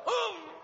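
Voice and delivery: sharp high yelp of pain, half laughing. Retold or imitated. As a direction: yelp like a sore spot got hit. Retold sudden pain from hit.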